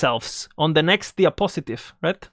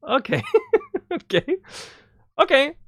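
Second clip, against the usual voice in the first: laughing okay; 9 semitones higher than usual. Retold or imitated. Reacting to James's serious answer.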